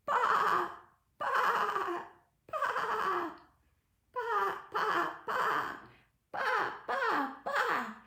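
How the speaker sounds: high, loud quavering bleats; playful high-pitched baas; sheep noises. Shown as a demonstration. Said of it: "Let's do some barn animal noises".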